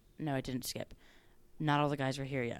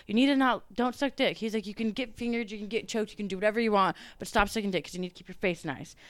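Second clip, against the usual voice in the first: higher, louder bossy warning; mock scolding director; 4 semitones higher, 5 dB louder than usual. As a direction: lay down the rules like a fussy director. Director scolding her on set.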